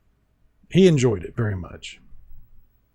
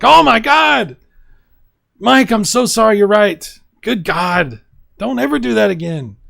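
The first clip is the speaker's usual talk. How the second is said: much higher and louder, mock horrified apology; horrified viewers; 8 semitones higher, 5 dB louder than usual. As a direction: play viewers begging forgiveness in shock. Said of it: "they'll be like".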